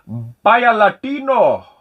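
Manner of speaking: slow, high, loud hammed-up Spanish accent. Naming a game for viewers in a hammed-up Spanish accent. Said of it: "I'm gonna do my best Spanish accent for you".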